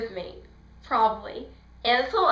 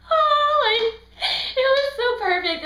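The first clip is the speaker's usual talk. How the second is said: high, loud squealing delight; fangirl squeal; 14 semitones higher, 7 dB louder than usual. Real, not acted. Fan gushing over her favorite line, squealing high. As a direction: squeal it like a fan who can't contain herself. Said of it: "smiling and fangirling in my seat".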